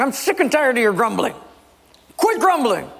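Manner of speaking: high, fed-up Moses scolding the crowd; exasperated, high-pitched scolding; acted anger. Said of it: "He was doing it out of anger".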